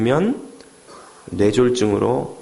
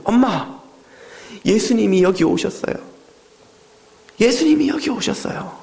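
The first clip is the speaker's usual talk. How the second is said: put-on excited child; 7 semitones higher than usual. Child tells mother Jesus came.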